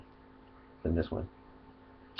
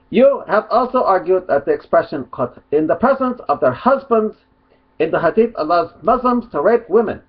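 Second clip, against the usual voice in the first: mock Arabic accent; 7 semitones higher, 3 dB louder than usual. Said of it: "I'll do it in a mock Arabic accent".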